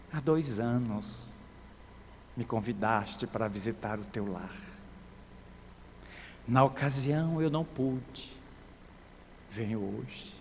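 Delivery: soft, low-pitched gentle reply. A narrator's reading. Jesus answering the dying leprous woman, gently. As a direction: answer softly and unhurriedly, voice well down. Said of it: "Ele responde suave".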